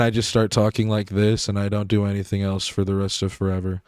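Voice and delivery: nonchalant, energy zero. Shown as a demonstration. Creator showing how he sounds when recording, energyless monotone. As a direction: drone on with the voice switched off. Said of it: "my voice c-, turns off".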